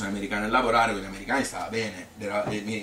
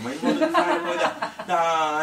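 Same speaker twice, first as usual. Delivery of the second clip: laughing; 12 semitones higher, 4 dB louder, slower than usual. Real, not acted. Laughing while recalling his manners.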